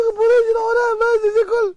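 high, loud mock wail to heaven; hammed-up sobbing protest; crying. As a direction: plead tearfully at the top of your range. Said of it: "זה בוכה, אומר".

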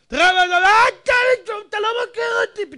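high, loud, blustering shout. Shown as a demonstration. Preacher showing how a temper ignites, angry shout. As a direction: flare up suddenly into a fast, high yell. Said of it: "מתחיל להרים קול".

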